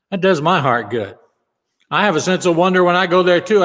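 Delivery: ordinary delivery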